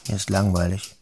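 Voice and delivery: usual speaking voice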